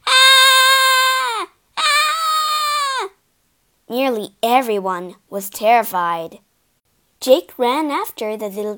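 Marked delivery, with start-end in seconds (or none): 0.1-3.1 long, high, loud ghostly howls; repeated high wailing screams; eerie howls. A narrator's reading. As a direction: howl on one high sustained note. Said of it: "The eerie howls of the little ghost".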